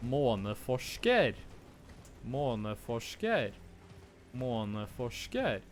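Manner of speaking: Måneforsker with a falling Norwegian pitch accent. Shown as a demonstration. Gamer trying Norwegian pitch accent on Måneforsker. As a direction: try Måneforsker with proper Norwegian tones. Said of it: "let me try to say it with, uh, the pitch accent".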